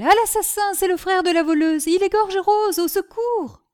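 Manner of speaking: high, loud alarm cry; shrieking for help at the mayor's house; crying murder. Acted. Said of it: "criait-elle". (crie à l'assassin, paniquée)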